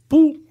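high, loud little "boop"; imitated hang-up beep. Man cutting off his father's call, imitated beep. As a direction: punch out a high phone beep.

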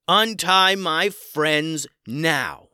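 loud, slow, forceful command; commanding shout. Acted. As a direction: order them sternly, word by word. Hero confronting captors.

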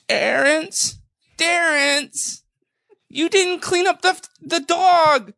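high, shrill scolding mother; a mother yelling at her child; angry mother. Retold or imitated. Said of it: "she's going".

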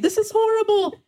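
sudden high wail of mock despair. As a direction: throw it up high like you're falling apart. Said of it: "I'm like".

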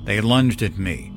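ordinary delivery, nothing marked

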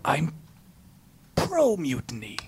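hushed secret whisper. Acted. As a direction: drop to a nervous whisper. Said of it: "why are you whispering that?".

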